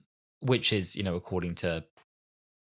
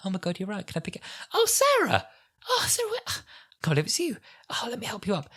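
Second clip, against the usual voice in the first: mock tender rescuer, pitched far higher; gushing concern; 13 semitones higher, faster than usual. The Doctor imagined rushing to help Sarah, mock gushing. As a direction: coo over her like a relieved friend.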